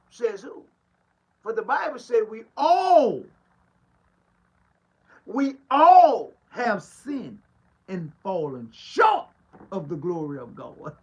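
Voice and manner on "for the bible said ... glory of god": loud, slow, stretched scripture; full-force preaching. Acted. Pastor quoting the Bible to his flock, loud and slow. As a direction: proclaim it from the pulpit.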